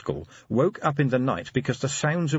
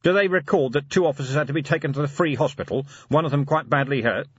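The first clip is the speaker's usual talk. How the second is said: quick, higher questioning; Vimes, indignant; 7 semitones higher, 4 dB louder than usual. A narrator's reading. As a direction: press the butler briskly for an answer. Vimes questions his butler.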